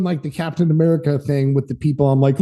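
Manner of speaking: usual speaking voice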